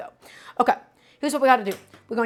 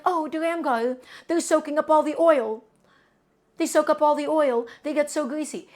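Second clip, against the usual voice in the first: lightly raised, whiny doubting viewer; imagined worried viewer; 5 semitones higher than usual. Pretend. Chef voicing a doubting viewer, mildly higher and whiny. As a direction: mimic a fussy skeptical viewer, a touch higher. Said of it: "you might be like".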